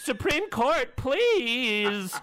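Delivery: high, hammed-up pleading voice; pleading lizard. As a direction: beg the Supreme Court like a fragile little creature. Voicing the endangered lizard pleading.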